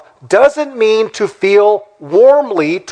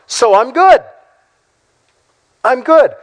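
higher-pitched, pleased-with-yourself quote; mock self-satisfied; 6 semitones higher, 3 dB louder than usual. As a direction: imitate someone smugly declaring themselves fine. Mimics self-satisfied new believer.

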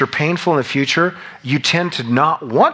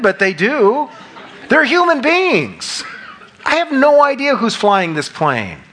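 animated; 8 semitones higher than usual. Real, not acted. Preacher's raised, animated point.

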